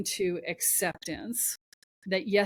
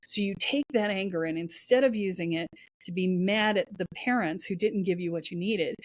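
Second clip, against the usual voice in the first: ordinary talk, nothing marked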